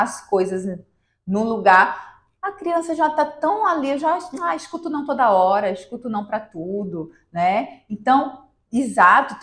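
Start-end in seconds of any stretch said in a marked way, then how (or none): 2.4-7.1 higher, lightly voiced weary child; a kid who has tuned out every no; child's voice. Mother voicing the child fed up with no, lightly acted.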